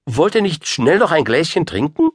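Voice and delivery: high, coaxing queen. A narrator's reading. Queen offering a quick drink, high and coaxing. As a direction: coax in a high, eager voice. Queen urges guests to stay.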